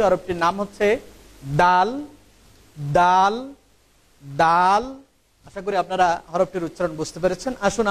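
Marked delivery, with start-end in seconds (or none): 1.6-4.8 teacher drilling দাল; repeated letter demo. Shown as a demonstration. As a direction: model দাল slowly for learners. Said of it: "আমরা আবার বলছি".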